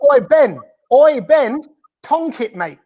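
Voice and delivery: high, drawn-out English "oi" call to a batsman; mock-English rallying shout, pitched high; Englishified cheer. Shown as a demonstration. Host showing player how to cheer on Ben, exaggerated English accent. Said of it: "gonna help you just to Englishify it".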